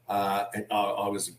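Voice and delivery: ordinary delivery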